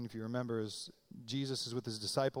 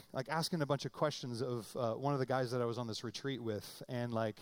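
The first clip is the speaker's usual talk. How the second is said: ordinary talk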